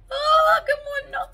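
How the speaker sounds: high loud squeal of delight. Real, not acted. Finding a cute product, squealing with delight.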